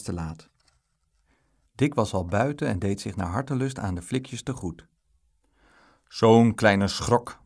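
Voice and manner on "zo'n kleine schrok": slow, loud grumble from the baker; disgruntled baker, dragging the words; grumbling. A narrator's reading. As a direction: grumble with drawn-out, heavy emphasis. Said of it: "mopperde de bakker".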